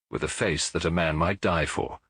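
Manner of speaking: ordinary delivery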